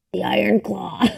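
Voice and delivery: whispered title, breathy hush. Real, not acted. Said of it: "Why do I always do that?".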